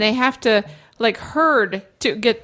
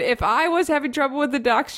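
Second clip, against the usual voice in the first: usual speaking voice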